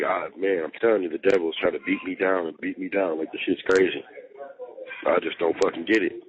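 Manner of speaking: normal talking voice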